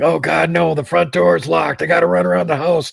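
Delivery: high-pitched mock panic; Chad panicking. Front door locked on him, imitated high panicky wail. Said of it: "we can literally hear Chad panicking".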